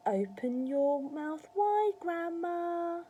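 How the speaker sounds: high, slow, drawn-out singsong; coaxing. A narrator's reading. George coaxing Grandma to take the spoon, singsong coaxing. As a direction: coax her slowly with stretched words.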